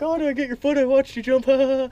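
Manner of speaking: high, squeaky mock of an older guy; mocking old coworker. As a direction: ham up the old guy's surprised question, high and loud. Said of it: "one of the older guys is like".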